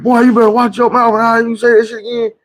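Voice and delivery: high-pitched scolding mother voice; scolding mama. Retold or imitated. Mother scolding her son for cussing in a high voice. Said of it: "my mama was like".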